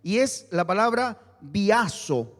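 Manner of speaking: usual speaking voice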